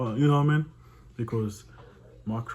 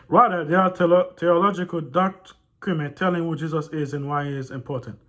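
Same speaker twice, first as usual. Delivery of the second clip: loud, higher read-aloud voice; 8 semitones higher, 11 dB louder than usual. A narrator's reading. Reading a disputed book to viewers, loud read-aloud voice. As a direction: project the text out loud.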